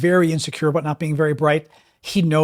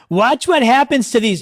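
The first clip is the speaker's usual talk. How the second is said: louder, raised, pompous bluster; a hammed-up Trump read, a bit higher and louder; mock Trump tweet reading; 6 semitones higher, 4 dB louder than usual. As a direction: ham up the president's boast. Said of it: "Listen to this tweet today".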